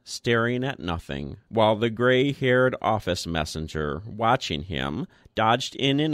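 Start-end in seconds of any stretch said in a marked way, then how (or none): none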